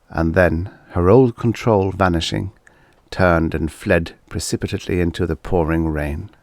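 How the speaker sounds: usual speaking voice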